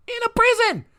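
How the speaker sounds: very high-pitched squawk of disbelief; incredulous outburst. Real, not acted. Outburst repeating the earlier line.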